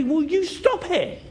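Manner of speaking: high, drawn-out exasperated rebuke; irritated. Acted. Disciples telling the noisy beggar to stop, irritated. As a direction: scold him to make him stop. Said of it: "become irritated by him".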